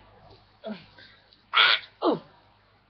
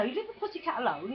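ordinary delivery

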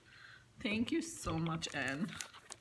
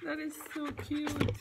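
higher and louder with delight; excited gush; 8 semitones higher, 4 dB louder than usual. Real, not acted. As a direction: gush over the gift. Gushing over gift.

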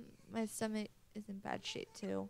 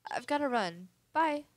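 calling out goodbye; 5 semitones higher, 9 dB louder than usual. Acted. Mother calls goodbye leaving.

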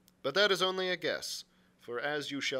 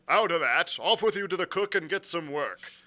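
loud, raised, commanding voice; crying out orders; 3 semitones higher, 7 dB louder than usual. A narrator's reading. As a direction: order the lad off sharply. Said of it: "he cried".

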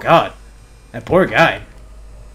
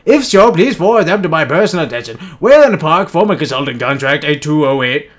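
ordinary delivery

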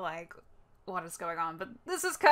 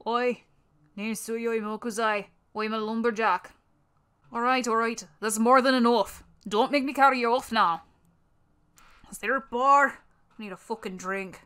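put-on Irish alcoholic giant softie; Irish accent. Lumberjack looking for a bar, put-on Irish accent. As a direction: play a gruff-hearted lumberjack with a faked Irish accent. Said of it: "an Irish accent".

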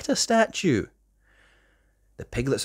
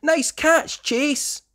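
much higher cheer; cheering pup; much slower than usual. Acted. Rocky cheers Chase's catch, high and bright. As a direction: cheer your friend on. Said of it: "cries Rocky".

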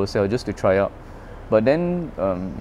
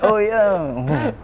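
put-on higher pitched, light childlike "oh yeah"; daughter's cute reply; 11 semitones higher than usual. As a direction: voice the little daughter, pleased. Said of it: "It's like she stick there".

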